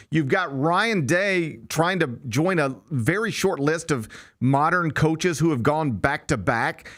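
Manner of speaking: usual speaking voice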